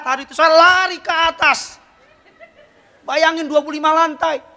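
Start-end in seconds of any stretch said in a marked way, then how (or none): none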